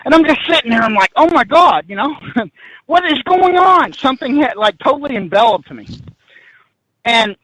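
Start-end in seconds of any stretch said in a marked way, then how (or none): 1.2-3.9 trapped and can't move; panicked. Retold or imitated. Stuck on the stairs, panicked cry.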